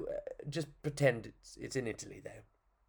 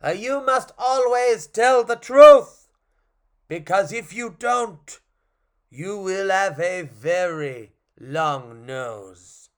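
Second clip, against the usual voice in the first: put-on slow, booming French-accented warning, easing off; 4 semitones higher, 9 dB louder, much slower than usual. Clown calling after the fleeing puppet, slow French accent. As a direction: call out the solemn rule, French accent. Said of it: "which is French".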